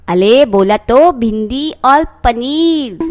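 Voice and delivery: lisped insistence, r turned to l; lisping. Acted. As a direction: repeat it with a childlike lisp. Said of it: "वो थोड़ी तुतलाती है बस". (तुतलाकर दोहराना)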